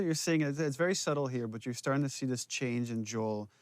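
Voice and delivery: usual speaking voice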